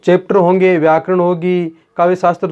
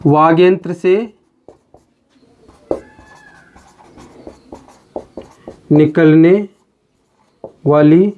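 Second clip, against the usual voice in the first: word-by-word dictation. Real, not acted. Teacher giving students the definition of वर्ण to write, word-by-word dictation.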